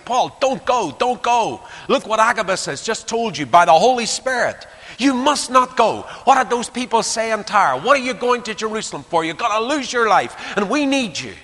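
high, loud tearful begging; pleading, weeping friends. Acted. Friends pleading with Paul not to go, tearful. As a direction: beg him not to go, near tears.

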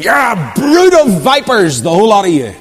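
fiery prophet condemning the Pharisees; denouncing preacher. Acted. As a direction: roar it at the crowd like John the Baptist.

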